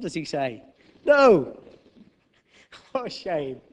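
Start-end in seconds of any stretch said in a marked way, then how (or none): 1.0-1.6 loud high firm no; mock Jesus no. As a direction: shut it down hard. Jesus answers flatly.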